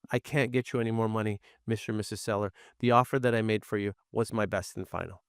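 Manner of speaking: quiet, firm, no-nonsense close; firm and final. Shown as a demonstration. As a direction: say it calmly and let it land as final. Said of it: "the best way is".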